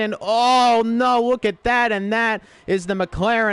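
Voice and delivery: sudden shock at the crash; alarmed commentator. Real, not acted. Car compromised by pit exit.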